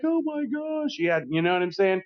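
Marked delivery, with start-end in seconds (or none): 0.0-1.0 much higher, imitating a thrilled squeal; mock delighted wife gushing; elated. Friend's wife thrilled at the surprise, imitated high squeal. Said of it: "his wife was fucking elated".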